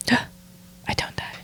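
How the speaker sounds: slow, whispered gasp of relief; relieved whisper. Real, not acted. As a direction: breathe out in relief. Relief after being targeted.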